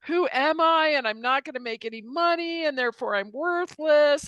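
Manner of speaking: high-pitched mock wail, no real tears; imitating a distraught lament; retold bawling. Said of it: "I came to him just bawling".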